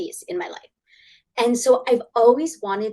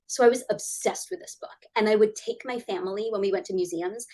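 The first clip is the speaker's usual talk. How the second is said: ordinary delivery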